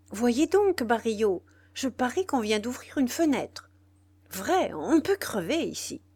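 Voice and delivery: loud, high complaining; irritated diva. A narrator's reading. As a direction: whine to the stagehand about the draught. Nana complains of the draught. (Nana se plaint à Barillot, fort et agacée)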